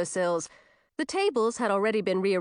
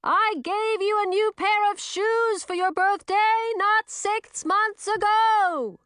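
high, slow, loud witch voice; booming, high-pitched protest; puzzled bellow; 4 dB louder, slower than usual. A narrator's reading. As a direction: bellow every word at a high pitch, drawn out. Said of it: "Chlorine bellowed, looking puzzled".

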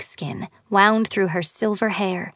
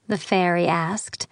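usual speaking voice

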